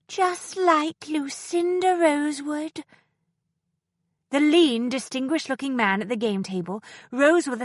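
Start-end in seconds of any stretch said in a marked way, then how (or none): none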